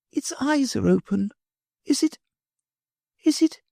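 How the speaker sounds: put-on a little girl hurrying off; high child's voice; little girl Sylvie. Sylvie nearing the hare.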